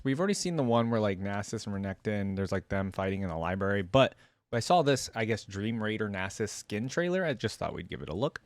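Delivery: ordinary delivery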